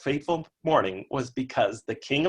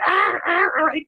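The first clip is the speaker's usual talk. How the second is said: seagull squawk; 6 dB louder than usual. Retold or imitated. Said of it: "a giant seagull".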